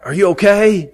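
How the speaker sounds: a sudden worried question; alarmed call. Retold or imitated. Asking a cousin just hit by flame, alarmed. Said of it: "I'm like".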